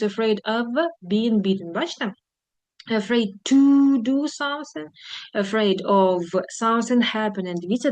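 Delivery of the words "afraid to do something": slow, higher demo, "to" hit hard; stress on to. Shown as a demonstration. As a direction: spell it out for the class, pushing "to". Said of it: "ходить з to".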